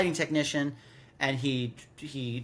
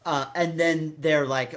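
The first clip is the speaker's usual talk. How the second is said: ordinary talk, nothing marked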